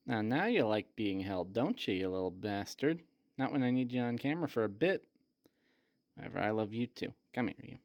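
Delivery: soft, low murmur to a pet; affectionate teasing. Real, not acted. Owner to squirming cat, softly affectionate teasing. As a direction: drop down low and coo a fond scolding.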